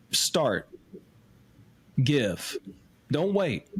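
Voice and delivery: each word set apart by long pauses; emphatic commands. Real, not acted. Host urging listeners to give, emphatic and slow. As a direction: hit start, give and don't wait like separate blows. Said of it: "Let me say that emphatically".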